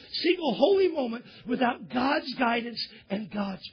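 loud, high preaching at full force; forceful preaching. Acted.

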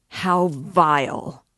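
loud, drawn-out exclamation; loud cry. A narrator's reading. Man in the doorway exclaims at the vileness, loud cry. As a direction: cry it out slowly and forcefully. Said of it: "a loud voice cried suddenly".

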